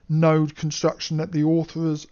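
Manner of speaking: normal talking voice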